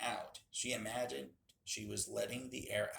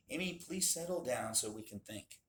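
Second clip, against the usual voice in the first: plain reading voice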